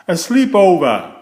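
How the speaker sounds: loud, high cry of delight; excited cry. A narrator's reading. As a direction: burst out with it, thrilled. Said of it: "Snail cried".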